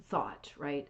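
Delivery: normal talking voice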